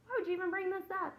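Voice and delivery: high, fast, offended disbelief; teacher playing hurt at a student's answer, high and fast; mock indignant. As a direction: squeak it out as if betrayed. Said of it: "I was like".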